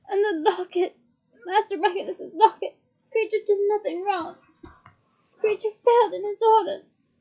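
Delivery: 1 distraught elf. A narrator's reading. House elf wailing to Harry about the locket, frantic. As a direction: wail out his failure in despair.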